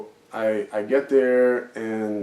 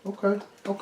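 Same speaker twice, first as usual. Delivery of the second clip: nervous okay; 10 semitones higher, 5 dB quieter than usual. Retold or imitated. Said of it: "He felt the intensity".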